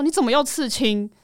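retold parent's indignant outburst; agitated parent. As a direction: act out an agitated parent's reproach. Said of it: "好激动".

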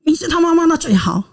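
mildly imitated angry retort, pitched high at the start; a touch of the mother-in-law's anger. Mother-in-law confronts the girl's mother, mock angry. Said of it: "我婆婆就很生气".

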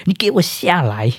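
high-pitched drunk calling out, imitated; mock a drunk hollering at his master; 模仿醉酒大喊; drunken yelling. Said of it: "我就很，很不礼貌的说".

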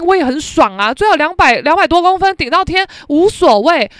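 loud, sassy tirade; loud indignant rant; furious rant. Retold or imitated. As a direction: tear into him, full voice. She rants at classmate.

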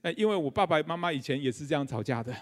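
normal talking voice

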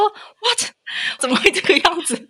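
high, slow stunned What; stunned disbelief. Retold or imitated. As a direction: gasp it out in disbelief. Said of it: "我就想说".

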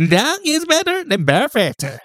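very high slow cutesy voice. Shown as a demonstration. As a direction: sweeten it like a cute child. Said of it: "用个讨喜的声音说说看".

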